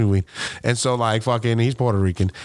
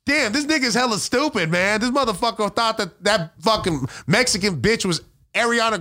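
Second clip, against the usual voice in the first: put-on much higher, brash teen voice; imitating a kid; 11 semitones higher than usual. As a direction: mimic a mouthy high schooler mocking someone. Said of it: "I heard a kid say".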